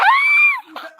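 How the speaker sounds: very high, loud burst of laughter; sudden squealing laugh; shrieking laugh. Real, not acted. Bursts out at the name.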